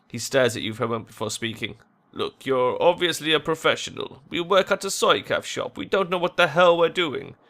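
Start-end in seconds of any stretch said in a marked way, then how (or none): 2.1-7.4 higher-pitched frank appeal; earnest worried shop worker. Acted. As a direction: admit helplessness to a professional.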